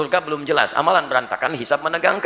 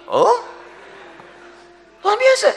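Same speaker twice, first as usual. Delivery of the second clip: mock amazement; 4 dB louder, slower than usual. Real, not acted.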